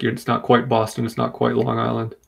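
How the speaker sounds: usual speaking voice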